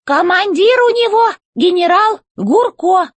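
high, slow, commanding robot boss; robot chef voice. A narrator's reading. Robot chef demanding answers from the old robot, high and commanding. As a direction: bark orders from up high like a tin general.

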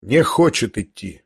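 ordinary delivery, nothing marked

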